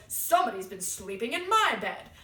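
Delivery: loud, indignant big bear; Papa Bear. Acted. Papa Bear finding his bed slept in, loud indignation. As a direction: boom it out, outraged, without raising the pitch. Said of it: "Papa Bear goes".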